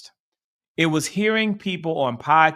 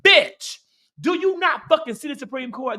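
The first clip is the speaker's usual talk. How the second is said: angry outburst; 9 semitones higher, 3 dB louder than usual. Real, not acted. Angry rant at pundits.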